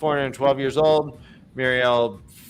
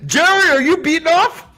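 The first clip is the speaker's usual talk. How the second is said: high, loud mock outrage; mock shock; 13 semitones higher, 5 dB louder than usual. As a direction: call it out in hammed-up alarm. Acting out a mock shocked scene.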